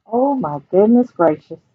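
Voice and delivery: ordinary delivery, nothing marked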